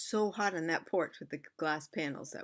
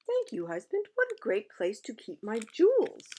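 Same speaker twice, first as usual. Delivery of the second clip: queen's voice; 5 semitones higher, 6 dB louder than usual. Acted.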